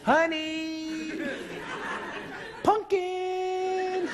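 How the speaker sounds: high sugary sing-song call; mock sweet calling. Husband calling his wife to the phone in a high sugary sing-song. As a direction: lure her to the phone with fake sweetness.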